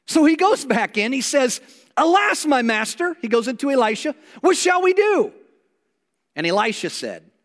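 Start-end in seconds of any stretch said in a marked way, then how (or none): 2.0-3.2 pitched up, alarmed plea; panicked servant. Retold or imitated. 4.4-5.3 pitched up, alarmed plea; panicked servant. Retold or imitated.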